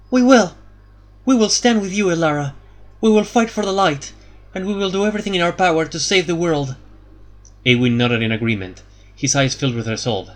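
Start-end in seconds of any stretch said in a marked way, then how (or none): 0.1-6.7 higher and louder, resolute; clear and strong. A narrator's reading. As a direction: answer the call firmly. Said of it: "her voice clear and strong".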